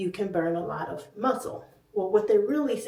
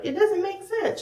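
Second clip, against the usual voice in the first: high-pitched mock complaint; baffled commenters; 12 semitones higher than usual. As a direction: imitate puzzled people in a raised voice. Quoting everyone's baffled comments.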